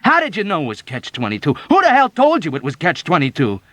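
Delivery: loud, high, insistent questioning; angry demand. Acted. Yossarian angrily pressing the old woman for answers. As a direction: press her hard for an answer.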